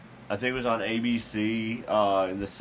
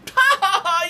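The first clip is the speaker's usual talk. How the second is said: high mocking cackle; 10 dB louder than usual. Mocking belly laugh.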